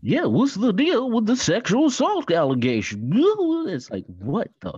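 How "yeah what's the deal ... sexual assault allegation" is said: high, loud standup comic voice; Jerry Seinfeld impression. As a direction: keep the Seinfeld bit going.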